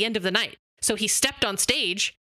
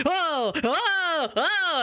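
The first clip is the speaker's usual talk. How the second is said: much higher mock gasps; amazed crowd; 8 semitones higher, much slower than usual. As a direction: play the astonished audience. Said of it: "people were like".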